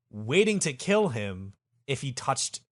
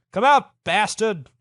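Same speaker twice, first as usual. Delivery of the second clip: high, loud demand; calling out; 7 semitones higher, 7 dB louder than usual. Acted. Lamson outside Farouk's palace, shouting his demand. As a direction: shout it at someone inside the building.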